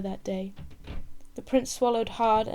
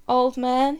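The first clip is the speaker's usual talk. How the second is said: much louder call down to him; hailing; 3 semitones higher, 9 dB louder than usual. Acted. Prince in a tree calling to the orange seller, a loud hail. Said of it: "he hailed".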